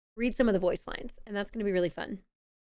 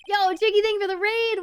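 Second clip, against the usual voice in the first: higher, loud cheer; excited greeting; 10 semitones higher, 6 dB louder than usual. Real, not acted. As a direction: thank the raider hyped. Greeting a raider.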